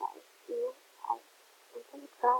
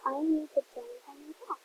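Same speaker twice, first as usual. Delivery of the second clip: usual speaking voice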